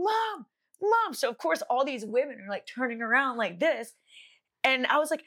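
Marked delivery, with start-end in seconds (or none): 0.0-1.1 high-pitched call for mom. Retold or imitated. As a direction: imitate calling across a crowd. Acts calling out to her mom.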